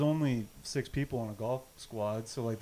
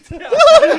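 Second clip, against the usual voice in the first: very high, loud laugh; shrieking laugh; 21 dB louder than usual. Real, not acted. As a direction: howl with laughter. Laughs at the story.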